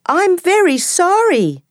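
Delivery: very high, squeaky mouse voice; tiny frightened mouse begging; scared little mouse. Acted. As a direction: pipe it up high like a timid mouse. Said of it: "The mouse is scared".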